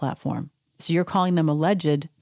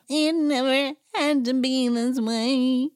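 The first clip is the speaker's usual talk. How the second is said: high, slow, mock-tearful drama; soap opera melodrama; 8 semitones higher, slower than usual. Imitating Erica crying melodramatically for the cameras. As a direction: ham it up like a daytime soap. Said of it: "very daytime Emmy, very soap opera".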